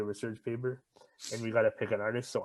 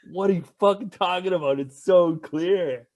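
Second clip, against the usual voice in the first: higher, louder indignant rant; mock exasperated rant; 7 semitones higher, 10 dB louder than usual. Friend mocking guests who can't see HD, loud and indignant. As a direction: rant like nobody sees the obvious.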